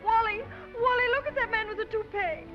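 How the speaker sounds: loud high call across the room. Acted. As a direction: call his name over the crowd. Calling out to a companion.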